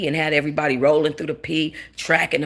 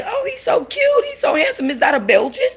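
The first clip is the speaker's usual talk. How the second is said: very high squeal of adoration, mimicked; high-pitched fawning stranger imitation; gushing admirers; 4 dB louder than usual. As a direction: coo over a cute puppy in a mock falsetto. Imitating admirers cooing over her dog.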